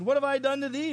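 talking donkey. Acted. Donkey confronting Balaam over the beating, mock indignant. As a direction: protest like a wronged donkey. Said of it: "So the donkey said".

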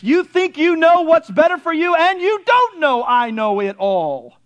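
emphatic pulpit declaration as God; forceful preaching. Acted. Preacher speaking as God to the self-assured, forceful preaching. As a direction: declare it with full authority.

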